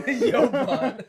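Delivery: laughing loudly while calling out. Real, not acted. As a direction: repeat it through a big laugh. Laughing repeat.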